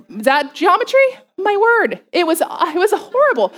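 high, mock-dramatic groan over the ordeal; exasperated. To the congregation, remembering the croissant class, mock-dramatic. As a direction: ham up how awful it was.

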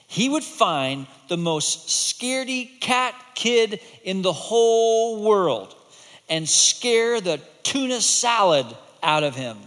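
slow, rising storyteller build; storybook build-up. A narrator's reading. Teacher building suspense for the class. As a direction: draw it out for the kids.

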